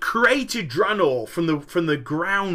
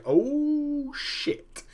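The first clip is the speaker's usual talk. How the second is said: mock shocked gasp; 10 semitones higher, 5 dB quieter than usual. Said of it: "are like".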